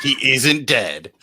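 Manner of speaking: slow dramatic trailer narration; horror trailer voice. Acted. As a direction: drag each word out ominously. Trailer parody continues.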